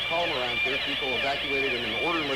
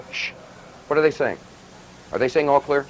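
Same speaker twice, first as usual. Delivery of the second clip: normal talking voice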